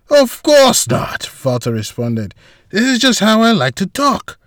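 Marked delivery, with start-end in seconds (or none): 0.1-1.3 slow, high, lightly voiced Walter; a hint of amused Walter; Walter's voice. Walter to boss, slow and high, lightly voiced. 2.7-4.4 high, lightly voiced Walter; a hint of casual Walter; Walter's voice. Walter explaining himself to boss, high, lightly voiced.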